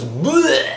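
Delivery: exaggerated vomiting noise; imitated puking. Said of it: "Just puked outside of the window".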